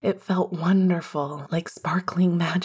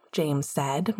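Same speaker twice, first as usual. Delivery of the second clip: usual speaking voice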